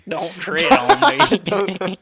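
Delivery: slow, loud, high mock-patriotic call; loud slogan. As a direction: belt it out like a rally cry. Echoing slogan, higher.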